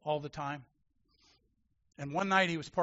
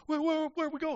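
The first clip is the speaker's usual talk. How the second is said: higher, hurried frightened question; nervous interpreter in broken English; 12 semitones higher than usual. Retold or imitated. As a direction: stammer where we go.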